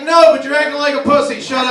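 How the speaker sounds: loud, dismissive dad; dad snapping. Retold or imitated. Dad telling his son to quit acting tough, loud. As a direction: bark it at the whiny kid. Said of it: "He's like".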